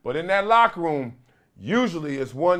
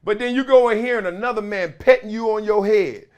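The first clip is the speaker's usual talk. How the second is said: usual speaking voice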